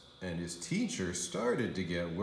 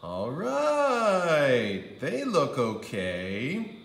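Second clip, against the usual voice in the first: grudging approval; 5 semitones higher, 4 dB louder, much slower than usual. A narrator's reading. Andrew grudgingly accepts.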